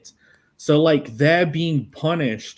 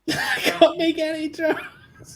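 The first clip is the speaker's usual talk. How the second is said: laughing; 12 semitones higher, slower than usual. Real, not acted. Laughing, holding back jokes.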